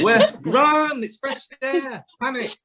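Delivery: high, hammed-up alarm at fresh air; mock panic. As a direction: pretend to flee in fright. Mocking kids fearing outdoors.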